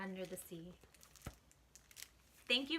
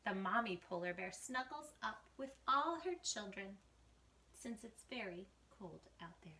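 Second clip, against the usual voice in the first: put-on lively read-aloud for kids, whispering the spooky bits; picture book rhyme, gently performed; story reading; 4 semitones higher, 4 dB louder than usual. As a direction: read to children, dropping to a hush for the mysteries.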